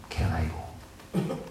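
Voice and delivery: slow sinister whisper; tempting whisper. Acted. Preacher whispering the tempter's quick fix to Cain, slow and hushed. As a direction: breathe it like a tempter in his ear.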